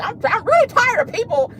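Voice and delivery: high mock of a woman complaining through twisted lips; mocking Courtney's voice. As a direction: whine it like a put-upon woman with a crooked mouth. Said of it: "Y'all know that's her voice".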